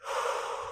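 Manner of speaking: a slow breathy snore out through the mouth; snoring breath. Shown as a demonstration. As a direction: breathe out like a sleeping bee snoring. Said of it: "we're going to make a snoring sound".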